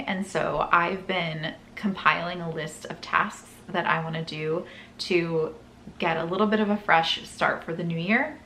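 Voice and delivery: ordinary talk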